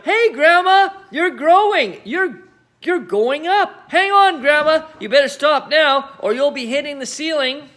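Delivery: high, loud alarmed calls; shouted. A narrator's reading. George shouts to his growing grandma, loud alarm. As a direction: call out in alarm. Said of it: "George gave a jump and shouted".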